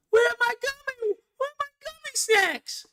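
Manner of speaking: put-on high, whiny little kid asking for snacks; whiny child voice. Child asks mother where the gummy snacks went, high whiny kid voice. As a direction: squeak it like a small child missing his treat. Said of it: "the baby for saying".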